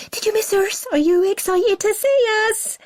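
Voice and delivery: put-on very high, bubbly child voice; excited little girl. A narrator's reading. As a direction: bounce with excitement like a small sister. Excited little sister greets him.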